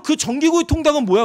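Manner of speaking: playful accusing question; mock challenge. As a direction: catch her out.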